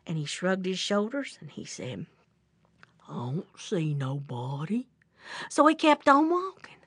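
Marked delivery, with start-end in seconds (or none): 3.1-4.9 low, soft, husky bear mumble; puzzled bear. Acted. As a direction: answer low and under the breath. Slow bear's reply in story.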